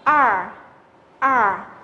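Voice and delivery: R said with the tongue curled back; retroflex R. Shown as a demonstration. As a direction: curl the tongue up and say R. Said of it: "chúng ta đã phát âm là R". (R đọc uốn lưỡi)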